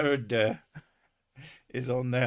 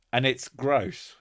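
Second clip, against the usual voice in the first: normal talking voice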